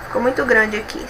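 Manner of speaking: ordinary talk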